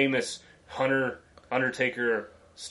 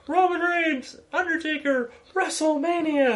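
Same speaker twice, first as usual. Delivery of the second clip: mock announcer; 14 semitones higher than usual. Mocking announcer voice.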